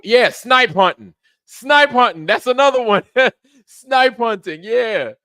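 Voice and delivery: loud high laughing outburst; laughing loudly. Real, not acted. Streamer cracking up loudly at a navy prank memory.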